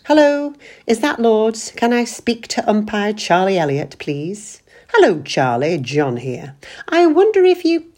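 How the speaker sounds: mock phone call, pitched high and loud; sarcastic. Husband mocks wife by acting out a phone call, sarcastic. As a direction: lay the sarcasm on thick. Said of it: "Cut out the sarcasm".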